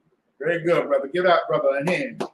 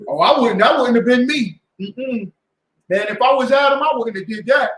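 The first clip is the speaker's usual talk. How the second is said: loud, self-righteous bragging, pitched up; boastful mock brag; 6 semitones higher, 8 dB louder than usual. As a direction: brag loudly like a know-it-all who would never sin. Mocking boastful churchgoers.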